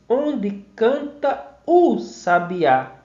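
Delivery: "canta o" as two separate vowels, slowly; slow "Onde canta o sabiá" with a distinct "o"; as written, unmerged. Shown as a demonstration. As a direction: keep the "a" of "canta" apart from "o". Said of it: "se a gente for escrever, a gente diz".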